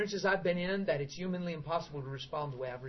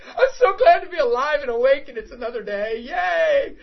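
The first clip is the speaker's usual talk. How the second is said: much higher and louder, laughing, half crying; ecstatic cheer; 10 semitones higher, 16 dB louder than usual. Retold or imitated. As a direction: shout your joy at waking up. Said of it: "flipping out".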